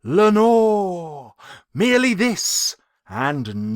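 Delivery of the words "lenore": a far-off echoing call, louder; echoing Lenore from the darkness. Acted. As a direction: let the name ring back like an echo. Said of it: "an echo murmured back the word".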